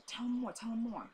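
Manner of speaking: softly imitated sick father; imitated weak urging. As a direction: quietly urge her on, as the father.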